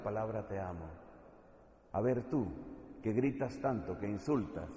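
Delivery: low, soft, close sermon voice; intimate quiet preaching. Acted. Preacher quietly confronting a scoffer, low and soft.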